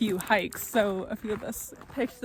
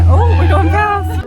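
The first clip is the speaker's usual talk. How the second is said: excited; 10 semitones higher, 15 dB louder, slower than usual. Real, not acted. Fast ski lift ride.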